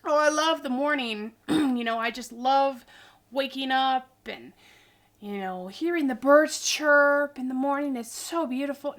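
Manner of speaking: loud, gushing mock cheer; hammed-up sing-song delight about birds; mock morning person. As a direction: parody a chirpy morning lover. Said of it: "Some people are like".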